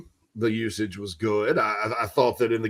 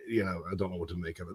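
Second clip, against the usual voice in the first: mock mumble; 5 semitones lower, 14 dB quieter, faster than usual. Self-mocking mumble.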